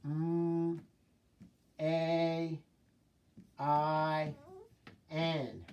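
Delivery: M, A, I, N each held as a separate sound. Shown as a demonstration. Teacher sounding main out for the class, one held sound at a time. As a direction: sound out M, A, I, N slowly with gaps. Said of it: "Now do main".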